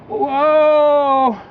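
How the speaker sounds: high, loud call to halt; a loud high whoa to a passing car. Retold or imitated. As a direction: flag the car down with your voice. Man waving lantern at car.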